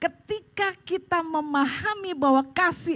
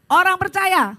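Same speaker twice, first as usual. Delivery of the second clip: loud, higher congregational answer; energetic, emphatic declaration; rousing call; 4 semitones higher, 8 dB louder, slower than usual. Real, not acted. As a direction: shout it out to lift a limp crowd. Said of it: "sekarang jangan lemes".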